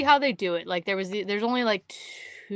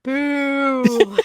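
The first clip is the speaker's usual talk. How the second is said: loud, high drawn-out boo; jeering call; 5 semitones higher, 7 dB louder than usual. Retold or imitated. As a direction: boo them from across the lake. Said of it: "either clapped or was like".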